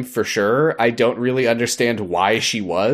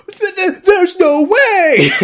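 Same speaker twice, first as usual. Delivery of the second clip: high, stammering mock-shocked cry; scandalized Victorian; 6 dB louder, slower than usual. Victorian viewer shocked by the costume change, hammed-up cry. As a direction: wail it slowly in hammed-up outrage. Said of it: "a Victorian person watching this would be like".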